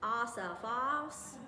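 "Asaphos" in a drawn-out Texas drawl, slow. Retold or imitated. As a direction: imitate an older Texan man stretching out "Asaphos". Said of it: "he got this drawl and he just says".

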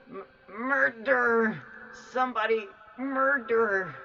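loud, high, stretched-out cry of alarm; horrified cry. Real, not acted. Gamer reacting to the murder, horrified loud cry. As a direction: cry it out as if you just witnessed a killing.

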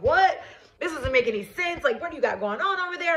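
high, fast, baffled man's voice; confused boyfriend. Retold or imitated. Ex-fiancé on the phone, high and baffled. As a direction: toss it off high and puzzled. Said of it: "He was just like".

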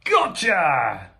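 drawn-out catching cry; triumphant shout. Acted. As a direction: shout it as you seize the villain. Mo grabs the thief.

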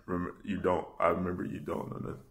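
ordinary talk

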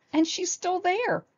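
high, drawn-out cub voice; high-pitched childish alarm; alarmed baby bear. A narrator's reading. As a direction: cry it slowly like a small child. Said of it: "stuttered Baby Bear".

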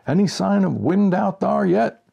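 loud, high call through the walls; shouting from inside. A narrator's reading. Rancid shouts to the narrator from inside the shack, loud and high. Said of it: "Rancid would shout at me from inside the shack".

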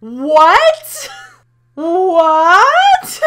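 high, loud rising yells of disbelief; can't believe what just happened; shocked shout. Real, not acted. Shocked by the game's ending.

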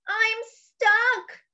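high-pitched storybook wail; crying out. Acted. Hippo squeezed in the shopping cart, crying out. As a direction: cry out in mock distress. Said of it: "cried Hippo".

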